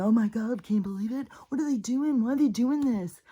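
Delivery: high-pitched imitation of incredulous complainers; hammed-up, high-pitched fretting; mock disbelief. Mimicking incredulous people on repeat.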